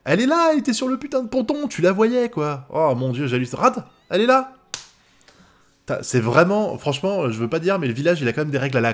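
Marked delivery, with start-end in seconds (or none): 0.0-2.7 fast, high-pitched outburst; indignant protest. Real, not acted. Proteste contre l'épisode. 3.6-4.5 high, loud insistence; exasperated protest. Real, not acted. Proteste contre l'épisode.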